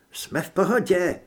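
ordinary delivery, nothing marked

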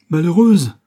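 loud, high cry of alarm; cried out. A narrator's reading. The father cries out at his daughter, a loud high cry. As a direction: cry out at her, appalled. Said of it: "s'écria Martin Rigal".

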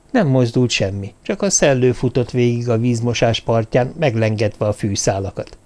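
ordinary delivery

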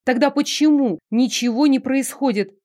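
plain reading voice

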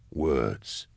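low, soft murmur; quietly, almost to oneself. A narrator's reading. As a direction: murmur it under your breath, pitch dropped. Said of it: "murmured Eisenhorn".

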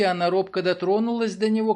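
usual speaking voice

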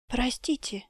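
hushed apologetic whisper; library whisper. A narrator's reading. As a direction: whisper it softly so no one hears. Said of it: "прошептала".

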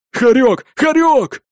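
shouting a name desperately; yelling. A narrator's reading. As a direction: yell it across the battlefield. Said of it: "орал кулак".

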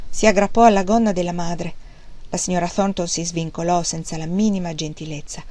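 usual speaking voice

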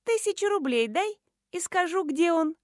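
put-on high, girlish bargaining voice; teenage girl. A narrator's reading. Schoolgirl offers to sell information, teenage voice. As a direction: pitch the deal like a cheeky kid. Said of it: "предложила сделку юное создание".

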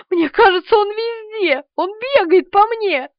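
high frantic cries; panicked squealing. A narrator's reading. Woman feels the roach running all over her, squealing. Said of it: "повизгивала Полина".

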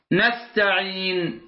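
نستعين very slow, the long ya drawn out; نستعين held long on the ya, final nun silenced; نستعين with a drawn long ya and a still nun; stretched pausal form. Shown as a demonstration. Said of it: "هكذا".

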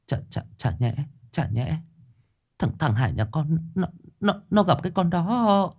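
words catching in her throat; mother realising her son met the ghost, stammering; stammering in fear. A narrator's reading. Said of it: "bà lắp bắp".

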